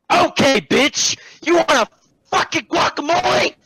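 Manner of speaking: high, loud, stretched-out outburst; exasperated yelling; mock-furious retort. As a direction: shout it like a sarcastic challenge. Acted fed-up retort to customer.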